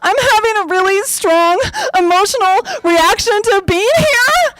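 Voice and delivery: high, tearful mock sobbing; imitating uncontrollable crying. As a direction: choke it out as if bawling. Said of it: "crying, crying, uncontrollably".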